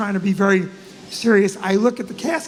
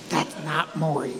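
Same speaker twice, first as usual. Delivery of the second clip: low, slow, hushed undertone; quiet, low-pitched confidential aside; discreet murmur; 9 semitones lower, 6 dB quieter than usual. Retold or imitated. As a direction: murmur it under your breath, slowly. Secretly telling Barry at funeral.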